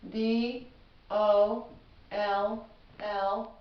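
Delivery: ordinary delivery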